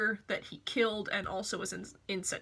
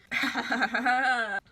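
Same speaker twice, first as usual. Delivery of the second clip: laugh; 2 semitones higher, 5 dB louder than usual. Real, not acted. Laughing at the story.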